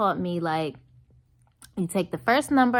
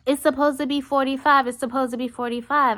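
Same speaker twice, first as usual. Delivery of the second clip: insistent waitress; 5 semitones higher than usual. Retold or imitated. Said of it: "She comes out talking about".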